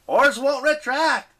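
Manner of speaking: slow, high, loud panicked report; frantic shout. Acted. Leo at the controls, frantic high shout. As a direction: call out in desperate alarm. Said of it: "Leo frantically worked".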